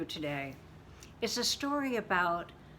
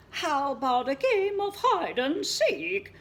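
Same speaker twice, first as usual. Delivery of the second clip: cheerful sheep; 10 semitones higher, 7 dB louder than usual. Acted.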